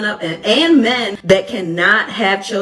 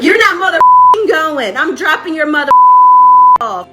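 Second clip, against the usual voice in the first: yelling mom; 11 semitones higher, 8 dB louder than usual. Retold or imitated. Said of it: "she's like".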